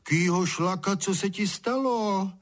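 high, wondering exclamation; feigned astonishment. A narrator's reading. Father pretends ignorance of the drenching, astonished. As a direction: marvel as if you knew nothing. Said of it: "divil se".

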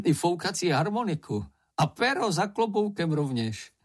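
high, merry lilt; amused delight. A narrator's reading. Šňupálek lists what he still has, high and amused. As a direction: list it with a touch of amusement. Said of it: "prohlásil Šňupálek se smíchem".

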